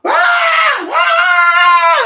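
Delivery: high piercing mock child scream, loud; loud imitated tantrum wail. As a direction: shriek like a toddler throwing a fit. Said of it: "their kid's just screaming".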